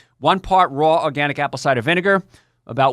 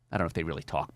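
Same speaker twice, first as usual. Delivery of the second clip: ordinary talk, nothing marked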